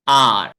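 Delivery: आर said loud, a long aa with a strong curled r; model R sound. Shown as a demonstration. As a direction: say आर for the class to repeat. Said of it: "तीन बार मैं बोलूंगा".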